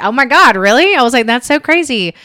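high, loud, amazed reaction; recalling her delighted surprise; excited. Retold or imitated.

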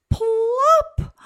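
very high, loud plop; big plop. A narrator's reading. Narrator drops the cone into water, loud. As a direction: pop the word out big.

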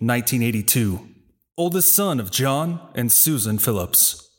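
ordinary delivery

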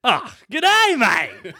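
high-pitched, hammed-up Aussie greeting; Australian accent. Shown as a demonstration. Asked to say good day in Australian, playful high Aussie accent. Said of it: "in an Australian accent".

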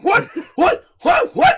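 imitated shock, climbing high and loud; Bernadette.

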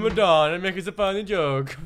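hammed-up Italian joker; Italian accent. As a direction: put on a broad Italian accent. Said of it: "why you sound Italian in my impression".

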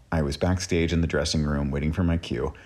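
plain reading voice

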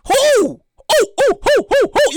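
high hooting who; hooting owl. Pretend. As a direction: hoot each who like an owl.

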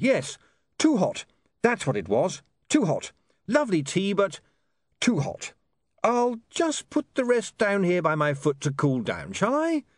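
high, hurried babbling cover-up; man who spat out the tea insisting it was too hot, flustered; flustered excuse. Acted. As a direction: talk your way out of being caught, a bit too eagerly. William covering after spitting tea.

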